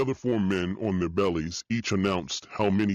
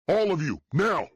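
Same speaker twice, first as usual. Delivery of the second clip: shouted orders; 10 semitones higher than usual. Acted. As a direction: shout over the ringing in your ears. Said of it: "I shouted".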